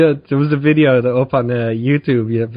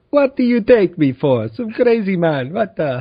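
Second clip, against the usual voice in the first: mock offended, higher-pitched comeback; indignant shopkeeper; 5 semitones higher than usual. Shopkeeper answers customer wanting dragon arrows, mock indignant. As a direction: take exaggerated offence at the request. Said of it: "when you talk to him".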